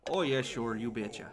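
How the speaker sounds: put-on playful Midwestern accent; Fargo accent. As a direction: do a Fargo-style "you betcha". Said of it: "that accent reminded me of some line from Fargo".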